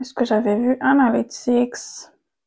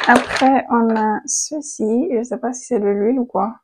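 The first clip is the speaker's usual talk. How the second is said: usual speaking voice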